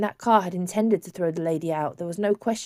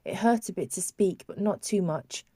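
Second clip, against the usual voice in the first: usual speaking voice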